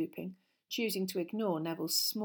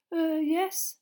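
put-on high, louder hesitant boy; nervous; 10 semitones higher, 8 dB louder than usual. A narrator's reading. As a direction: answer jumpily, pitched right up. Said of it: "said Neville nervously".

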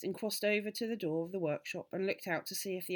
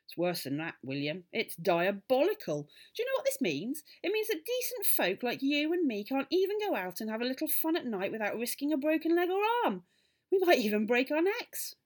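high, loud, mounting outrage; scandalised tirade, stressing key words hard; furious indignation; 7 semitones higher, 5 dB louder than usual. A narrator's reading. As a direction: rant on, climbing to a near shout. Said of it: "jumping up and down with fury".

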